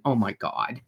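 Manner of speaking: put-on wife unimpressed by her pillow boyfriend, exasperated; eye-rolling. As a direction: roll your eyes and sigh it out under your breath. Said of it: "rolling her eyes going".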